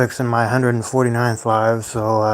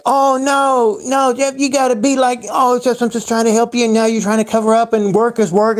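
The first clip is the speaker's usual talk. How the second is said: high, mocking parent voice, a touch louder; mock parent whine; 11 semitones higher, 3 dB louder than usual. Mocking the parent who says he is just helping. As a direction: whine it like a guilt-tripping parent. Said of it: "then he's like".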